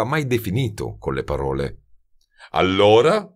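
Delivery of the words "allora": loud impatient Allora, rising; thundering. A narrator's reading. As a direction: boom it out impatiently. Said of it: "tuonò Terrier".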